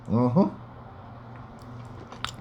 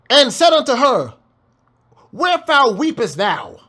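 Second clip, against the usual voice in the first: scripture reading; 5 semitones higher than usual. Acted. Reads scripture aloud.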